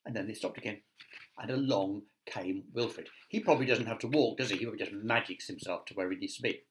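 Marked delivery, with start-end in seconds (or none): none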